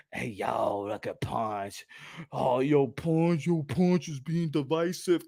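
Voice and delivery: low, soft mock voice of a complainer; mocking imitation of critics. Critics complaining about Punch being divisive, mocking imitation.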